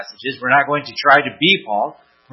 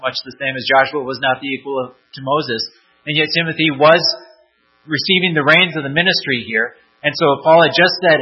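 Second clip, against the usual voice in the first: ordinary delivery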